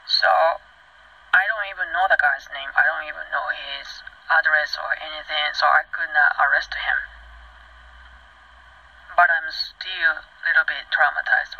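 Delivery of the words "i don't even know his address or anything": normal talking voice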